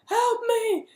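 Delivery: very high-pitched imitated cry; shrill pretend plea for help; mock scream for help. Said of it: "screaming, like".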